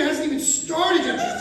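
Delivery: mock yell. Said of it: "I'm like, screaming".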